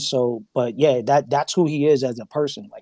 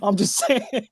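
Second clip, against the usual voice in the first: joking disclaimer; 7 semitones higher, slower than usual. Real, not acted. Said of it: "Not to stereotype".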